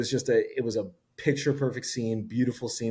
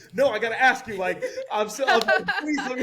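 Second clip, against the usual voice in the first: laughing; 11 semitones higher than usual. Real, not acted. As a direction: giggle out the plea to let him ask. Laughing while insisting on proposing.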